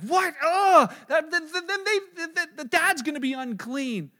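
loud, high, stammering disbelief; shocked outburst. Acted. Listeners aghast that the dad embraced him, acted shock. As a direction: cry out appalled and stumble over the words.

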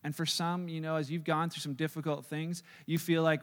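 ordinary talk

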